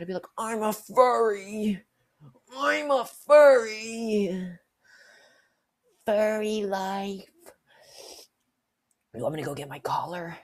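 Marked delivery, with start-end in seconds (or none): 0.4-7.3 high, loud, drawn-out cry; loud slow furry cheer; furry proclamation. Retold or imitated. Proclaiming to scared coworker. 9.1-10.4 whispered creepy offer; creepy whisper to coworker. Retold or imitated.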